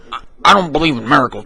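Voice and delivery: ordinary delivery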